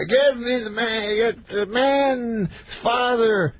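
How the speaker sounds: high, very drawn-out order; Bill Cosby. Retold or imitated. Star orders agent about fan's father, mimicked slow high drawl. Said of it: "And he goes".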